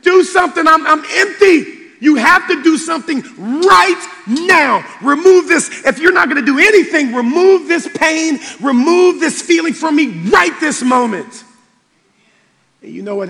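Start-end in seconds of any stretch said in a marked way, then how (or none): 0.0-11.4 loud, raised desperate prayer re-enacted; bawling plea. Pastor begging God to remove the pain, loud desperate plea. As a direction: act out begging God, louder and higher. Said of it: "and I'm bawling".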